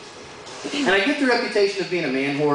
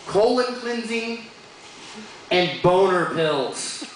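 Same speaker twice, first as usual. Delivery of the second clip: drawn out; much slower than usual. Real, not acted. Late-night TV products spelled out.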